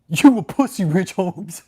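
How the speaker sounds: high, loud wheezy taunt at Rich Holmes; loud jeering cult-leader taunt; mocking David's taunt. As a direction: bark it like a winded bully sitting on his chair. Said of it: "He's like".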